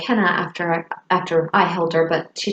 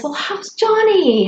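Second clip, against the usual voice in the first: high, slow bright asking voice; imitating the friend's cheerful inquiry; chirpy question; 12 semitones higher than usual. Friend casually asks about Kate's husband, chirpy. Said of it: "And she says".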